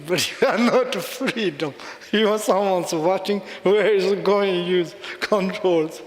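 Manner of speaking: laughing through a teasing comeback; laughing retort. Retold or imitated. Teasing the priest friend, laughing. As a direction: chuckle while needling him. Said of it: "Buddhist is, "Yes".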